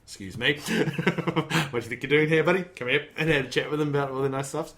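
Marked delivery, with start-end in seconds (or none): 0.0-3.1 a policeman calling someone over; stern cop. Retold or imitated. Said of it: "the cops are like".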